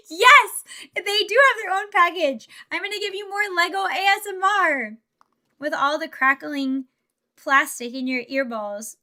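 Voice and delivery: high, loud and eager; excited loud reply. Real, not acted. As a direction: bubbly burst of excitement for the chat. Excited answer to chat.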